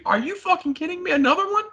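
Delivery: high-pitched mock exasperation; retold incredulous outburst. Woman fed up with her boyfriend leaving, retold as high incredulous outburst.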